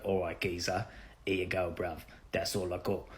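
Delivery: mock British street slang accent, said softly; fake British accent. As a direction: put on a fake British accent, kept quiet. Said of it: "fake British accent".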